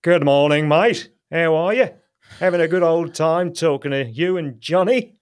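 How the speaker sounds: loud, high, cheery Cockney greeting; Cockney bloke greeting the hosts, loud and chirpy; Cockney accent. Shown as a demonstration. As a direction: hail a mate like a London Cockney. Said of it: "English Cockney".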